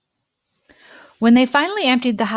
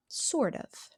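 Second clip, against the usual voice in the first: soft aside; 3 semitones higher, 12 dB quieter than usual. A narrator's reading. Deadpan aside after adventure.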